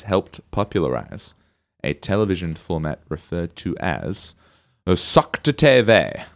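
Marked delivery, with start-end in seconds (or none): 4.9-6.2 slow, loud, overdone Norwegian accent; bad Norwegian accent. Shown as a demonstration. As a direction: drag it out in a hammy Norwegian accent. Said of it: "which is my worst Norwegian accent".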